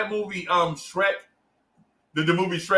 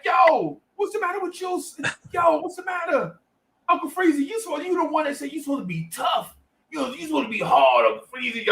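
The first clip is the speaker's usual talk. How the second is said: high-pitched pleading kid impression; mock-urgent high voice; alarmed young friend; 11 semitones higher than usual. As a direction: imitate a worried pal, pitched way up. Young friend alarmed at his crying.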